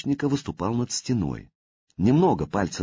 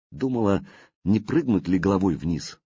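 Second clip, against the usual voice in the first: plain reading voice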